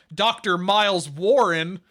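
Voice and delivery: slow, loud name drop to camera; drawn-out, emphatic retold reveal; dramatic reveal. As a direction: announce the name with mock grandeur. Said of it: "he turns to the camera".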